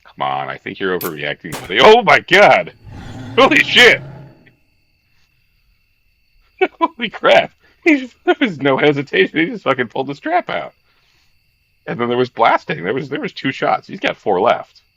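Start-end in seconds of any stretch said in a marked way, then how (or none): 1.8-7.5 real shocked yells, much higher and louder, laughing at the end; shocked outburst. Real, not acted. Streamer shocked by in-game shooting. 7.8-14.8 higher and louder, rushing through the recap; excited disbelief. Real, not acted. Streamer recounting shock excitedly.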